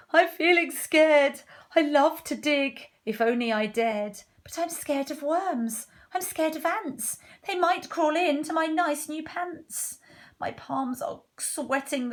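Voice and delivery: high-pitched worried voice; timid cook, scared. Acted. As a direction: play a wimpy cook fretting.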